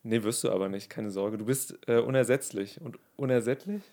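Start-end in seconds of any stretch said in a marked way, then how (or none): none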